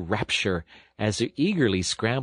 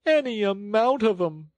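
high-pitched theatrical sob; sobbing; 12 semitones higher, 3 dB louder than usual. A narrator's reading. Toad as washerwoman lamenting the children, sobbing. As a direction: wail it as if in tears. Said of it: "sobbed Toad".

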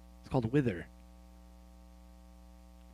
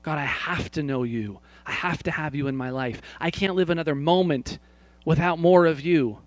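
desperate prayer; 3 dB louder than usual. Acted. Pastor voices a desperate prayer to God, building to a loud cry. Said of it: "Or maybe it's more desperate".

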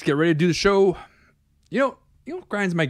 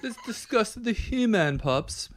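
slow, softer, higher mock British; British accent; 7 semitones higher, 5 dB quieter, slower than usual. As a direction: attempt a put-on British accent, unhurried and raised. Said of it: "episode with a British accent".

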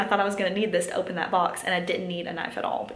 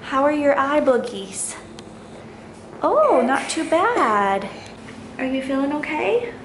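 sing-song mommy wake-up voice, pitched up; 6 semitones higher, slower than usual. Real, not acted. Mother waking her toddler from nap.

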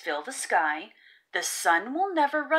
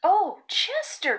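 higher, louder, slow dismayed cry; mother crying out to her upset son; cried out; 9 semitones higher, 5 dB louder, slower than usual. Acted. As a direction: rush to comfort him, caught off guard. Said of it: "cried Missus Raccoon".

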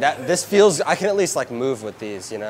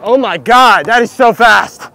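higher, louder and slow; shocked exclaim; 7 semitones higher, 11 dB louder, slower than usual. Real, not acted. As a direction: gasp it out in disbelief. Slap shot fired at him.

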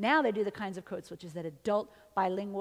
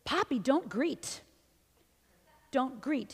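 put-on little boy; 4 semitones higher, slower than usual. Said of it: "y el niño voltea y le dice".

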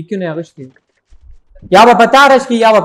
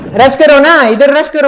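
shouting; 4 semitones higher, 4 dB louder than usual. Real, not acted. Pushing teammates to rush.

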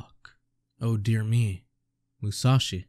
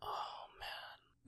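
whisper; 20 dB quieter than usual. Real, not acted. Stunned reaction while reading.

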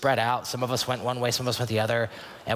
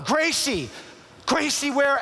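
frantic yelling; slower than usual. Retold or imitated. Father searching for his lost daughter, frantic yell. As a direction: scream her name as if she's lost. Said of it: "now I'm just yelling".

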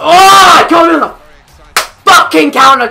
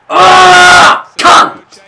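explosive cursing yell, louder and higher; frustrated shout; 7 semitones higher, 6 dB louder than usual. Real, not acted. Gamer curses the game after a chance goes, angry yell. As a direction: burst out swearing at the game. Enraged outburst at missed stop.

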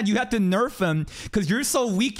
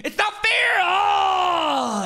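frustrated shout; 9 semitones higher, slower than usual. Real, not acted. Gamer raging at the double barrier, shouting. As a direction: vent at the game at full volume.

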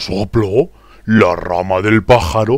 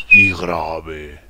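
low, drawn-out pitch; voz grave; 4 semitones lower than usual. Shown as a demonstration. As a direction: drop the voice down low. (voz grave, alargada)

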